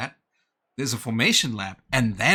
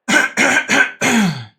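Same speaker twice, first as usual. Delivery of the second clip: loud, high throat clear in bursts; big drawn-out throat clearing; 13 semitones higher, 6 dB louder than usual. Real, not acted. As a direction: clear the throat hard, several times. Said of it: "Let me clear my throat".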